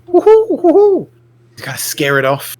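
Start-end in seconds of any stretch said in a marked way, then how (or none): 0.1-1.1 high, loud hooting call; shouting a warning. Pretend. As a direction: hoot loudly to scare it off. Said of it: "do a, a warning call".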